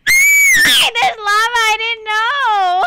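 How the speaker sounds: high, loud panicked cry as lava rises; panicked yell. Real, not acted. Gamer yelling at her viewers as lava rises, panicked. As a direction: shriek in real alarm, voice cracking. Lava rising under the player.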